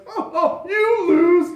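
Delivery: high gloating cry, swelling loud; mock-pitying, then loud taunt; dragon king character. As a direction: gloat like a cruel game master. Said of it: "and he's like".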